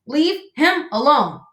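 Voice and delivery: high, slow, each word punched; fierce command. Acted. Old goose defends gosling from pike, fierce slow command. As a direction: order the attacker off, word by word.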